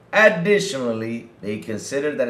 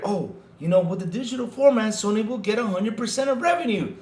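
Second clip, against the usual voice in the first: slightly higher mocking imitation; mock Sony defender; 7 semitones higher than usual. Host imitates a journalist defending Sony, mild mock defender. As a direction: lightly parody someone making excuses. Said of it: "It's like he's defending Sony".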